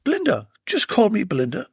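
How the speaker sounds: high, coaxing lady; a lady insisting gently; Belinda's voice. Acted.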